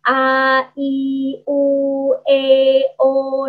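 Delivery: A, I, U, E, O one by one, evenly held; vowel articulation drill. Shown as a demonstration. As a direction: separate each vowel A, I, U, E, O with small pauses. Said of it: "latihan itu".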